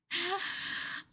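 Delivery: sharp high gasp of delight. Acted. Girlfriend gasping at her partner in the collar. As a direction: gasp as if he takes your breath away.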